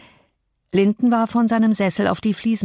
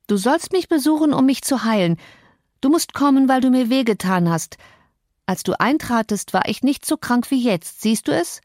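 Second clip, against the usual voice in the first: higher, pleading invalid whine; cling to her with a spoiled, needy whine; whining sick boy; 6 semitones higher than usual. Acted. Linden begging Cathy to keep visiting, high and whiny.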